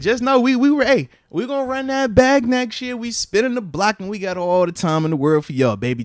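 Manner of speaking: loud, high trash-talk to the rival; shouting that we'll run it back; hyped challenge. Real, not acted. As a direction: pump it up like a promise to the other school. Hyping his team's rematch.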